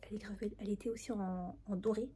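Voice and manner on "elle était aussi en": soft whisper, keeping it hushed; hushed unboxing whisper. Real, not acted. Whispering her haul so nobody wakes up.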